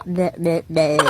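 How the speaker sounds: low mocking blah-blah babble. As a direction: tease with a blah-blah noise. Child mocks mother with babble. (nang-aasar na daldal)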